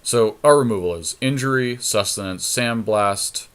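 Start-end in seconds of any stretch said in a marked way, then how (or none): none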